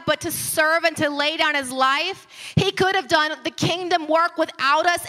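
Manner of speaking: rising preacher's voice, pressing the point; fervent pulpit preaching; impassioned preaching. Acted. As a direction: preach it with mounting force. Building sermon passage.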